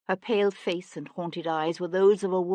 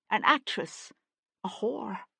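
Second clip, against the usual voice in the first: bitter self-reproach; 5 semitones higher than usual. A narrator's reading.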